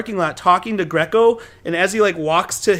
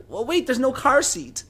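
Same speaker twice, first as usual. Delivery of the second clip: high, slow, softer fretting nanny; fussy nanny; 5 semitones higher, 6 dB quieter than usual. Retold or imitated. Nanny fretting as she is taken hostage, high worried voice. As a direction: worry aloud in a small high voice.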